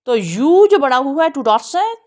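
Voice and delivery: ordinary talk, nothing marked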